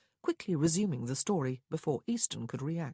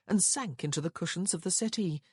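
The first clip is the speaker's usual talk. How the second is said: usual speaking voice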